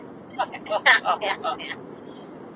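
breathy chuckle in short bursts; a few quick, airy laughs; laughing. Real, not acted. Laughing with a friend in the car, breathy chuckle.

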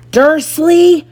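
loud, high bellow of the name; boomed. A narrator's reading. Hagrid about to explode at Dursley, boomed. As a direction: call him out at full voice. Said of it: "he boomed".

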